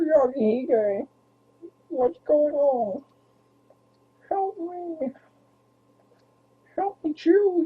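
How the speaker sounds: very high, drawn-out puppet-frog voice; slow, pleading froggy squeak far above normal pitch; Kermit the Frog voice. Acted. Owner voices the dog pleading with Mister Kyle, high Kermit voice. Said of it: "give you the Kermit the Frog voice".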